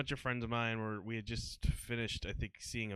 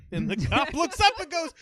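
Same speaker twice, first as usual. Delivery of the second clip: ordinary talk, nothing marked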